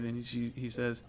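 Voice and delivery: ordinary talk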